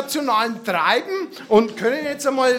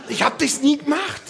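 usual speaking voice

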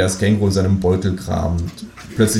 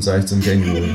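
ordinary delivery

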